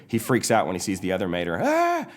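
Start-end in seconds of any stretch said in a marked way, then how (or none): 1.6-2.1 high loud shriek; freaked-out scream. Acted. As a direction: scream at the sight of yourself. Mater sees other Mater.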